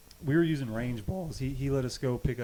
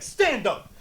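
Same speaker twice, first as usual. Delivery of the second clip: loud, higher command; coach's order; 10 semitones higher, 6 dB louder than usual. Retold or imitated. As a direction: order him up. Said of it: "He's like".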